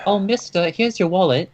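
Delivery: put-on high, eager little kid voice; sweet newsboy urchin; wholesome 1950s kid. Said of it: "giving a line ... ragamuffins in the street".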